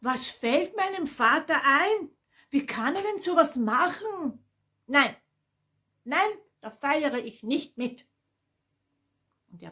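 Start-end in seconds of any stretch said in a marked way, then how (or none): 0.0-8.1 high, loud indignant outburst; furious son. A narrator's reading. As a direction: protest angrily, pitch leaping up. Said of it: "rief der Sohn wütend". (wütend und empört)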